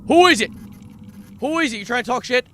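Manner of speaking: high, loud challenge at something unseen; startled shout. Real, not acted. Streamer yelling at whatever just hit the sub, startled shout. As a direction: yell it out, rattled and defensive.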